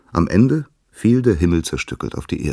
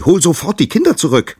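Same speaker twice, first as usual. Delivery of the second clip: very high, loud command; screamed demand; 14 semitones higher, 8 dB louder than usual. Acted. Gaia ordering Uranus to free the children, screaming high. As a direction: shriek the order at him. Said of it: "schrie sie".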